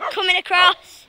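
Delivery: loud, high call while crossing the stream. Real, not acted. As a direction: shout it out as you step across.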